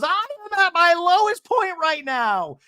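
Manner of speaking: high, loud, slow mock wail; mock meltdown. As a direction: wail it out, imitating a dramatic breakdown. Acting out Alexis's meltdown.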